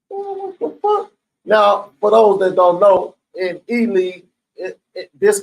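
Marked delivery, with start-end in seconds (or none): 0.1-1.1 high, stunned "what the fuck"; shocked outcry. Retold or imitated. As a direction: react in sudden disbelief, pitched way up. Shocked at water on floor.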